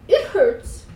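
high, pained little complaint; whimper. A narrator's reading. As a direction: whimper it, pitched well up. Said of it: "I whimpered".

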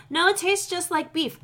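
mocking. Mocking vegans' claim.

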